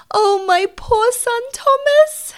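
very high, loud, drawn-out wail; anguished exclamation. Acted. Mother realising her son is in danger, anguished wail. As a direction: cry out slowly in high-pitched horror. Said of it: "exclaimed Tabitha, her paws on her cheeks".

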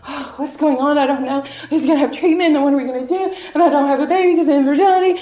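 high, loud, rushing fretting; mock panic. Speaker imitates us overwhelmed by troubles, mock panic. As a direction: pile up the worries in a hammed-up fluster.

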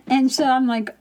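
ordinary delivery

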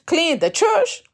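scoffing, incredulous question rising high; mock disbelief at being asked to clean; a touch of sneering disbelief; derogatory tone. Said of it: "Kind of a derogatory tone that I asked the Lord".

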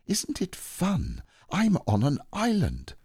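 put-on high, excited boy's voice, drawn out; eager Christopher Robin. Boy telling Owl about his island, high and delighted.

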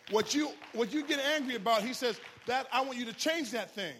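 loud, high, rising sermon climax; fiery pastor driving the sermon home; full-force preaching. Acted. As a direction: preach it hard to the congregation.

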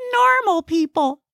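very high comic character voice; high-pitched little know-it-all; chatty Gnesi. Acted. Gnesi makes conversation while driving, high chatty voice.

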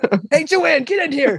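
loud, high shout to another room; yelling across the room. Retold or imitated. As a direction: call her in from across the house. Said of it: "best name to yell across".

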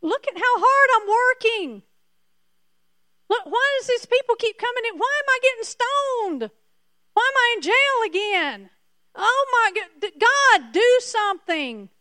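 high, loud, pleading complaint to God; whining Paul. Retold or imitated. Preacher acting out Paul begging God to fix his troubles, high pleading whine. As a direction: whine to God like a worn-out apostle. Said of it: "Three times he did this".

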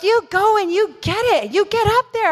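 high, bright urging from friendly strangers; a voice pushing you forward to run; cheering encouragement. Retold or imitated. A travelling lady cheers the preacher on, high and urging.

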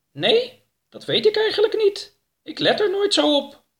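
very high, thin falsetto character voice; Oroka, unsure. A narrator's reading. Oroka admitting he never notices, high character voice. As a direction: pitch it way up for an innocent reply.

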